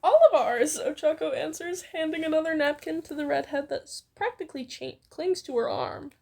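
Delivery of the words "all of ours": loud cheerful chorus answer; bright loud reply. Acted. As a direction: proudly own up to it. Ochako proud answer.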